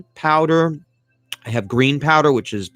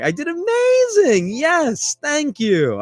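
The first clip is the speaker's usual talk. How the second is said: very high, squealing mock triumph; gleeful celebrating; slower than usual. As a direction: squeal with delight at your own success. Celebrating the listeners' praise.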